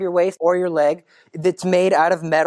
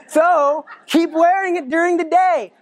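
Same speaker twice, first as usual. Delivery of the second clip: higher, laughing through the words; 8 semitones higher than usual. Real, not acted. Teacher laughing at Mary's instruction to the children, much higher. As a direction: laugh at the irony as you say it.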